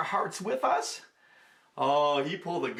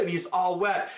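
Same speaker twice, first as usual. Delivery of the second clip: plain reading voice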